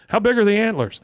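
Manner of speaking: high, loud excited asking; imitated eager question. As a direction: pitch it up with mock eagerness. Voices Hank's excited question.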